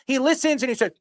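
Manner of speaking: normal talking voice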